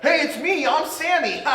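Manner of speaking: mock Sammy. [name] joking on the phone with Oak, high silly voice. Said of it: "he's like".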